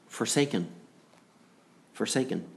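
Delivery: soft, grave repetition of a single word; hushed solemn echo. Retold or imitated. Preacher dwelling on Christ's word from the cross, hushed and solemn. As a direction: drop the voice and let each word hang.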